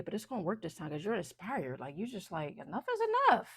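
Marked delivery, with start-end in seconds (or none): 2.6-3.5 high, loud declaration; emphatic resolve. Acted. Voicing the inspired client drawing a line, emphatic resolve. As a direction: put your foot down, pitched way up.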